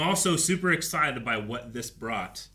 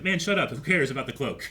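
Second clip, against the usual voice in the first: higher, louder mock scolding; mock exasperation; 5 semitones higher, 6 dB louder than usual. Host imagining scolding himself over trivia, mock exasperated. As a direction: blurt out an exasperated self-rebuke, playfully. Arguing with himself about his priorities.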